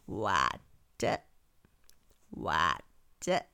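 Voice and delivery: low, drawn-out frog call. A narrator's reading. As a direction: call after her in a deep frog voice. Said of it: "rief der Frosch".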